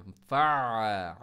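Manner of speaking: फ़ा stretched long, no stop; unstopped ain. Shown as a demonstration. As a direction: hold फ़ा without cutting off. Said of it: "stop नहीं होना चाहिए". (फ़ा लंबा, बिना रुके)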